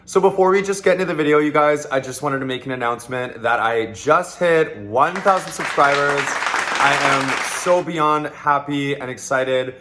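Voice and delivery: loud, bubbly shout-out; excited announcement. Real, not acted. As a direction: hype up the milestone. Subscriber announcement, hyped up.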